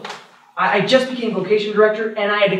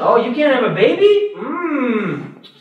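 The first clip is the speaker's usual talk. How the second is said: higher, gossipy mock shock; mocking gossip; 4 semitones higher than usual. Preacher imitating neighbours shunning an infertile woman, mocking gossip. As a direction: sneer like a village busybody.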